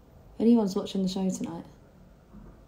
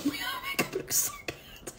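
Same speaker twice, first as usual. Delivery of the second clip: whispering; 7 dB quieter than usual. Real, not acted. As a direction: giggly whisper to herself. Laughing aside about makeup.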